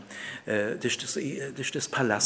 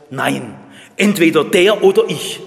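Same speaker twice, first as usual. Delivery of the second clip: loud, high, hard refusal; harsh and curt; 4 semitones higher, 12 dB louder than usual. Acted. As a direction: slam the door: it's him or me. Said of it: "die sagen hart und schroff".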